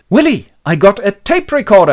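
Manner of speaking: high, loud showing-off; acted enthusiasm. Howard shows Willie his new gadget, excited.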